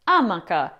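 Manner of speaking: amaca mispronounced as A-ma-ca; first-syllable stress. Shown as a demonstration. Teacher showing what some Italians say, amaca stressed on first A. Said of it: "stressing the first syllable".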